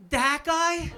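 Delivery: loud, high disbelieving "That guy?"; a shocked friend blurting out; incredulous. Retold or imitated. Friends meeting the boyfriend, loud shocked disbelief.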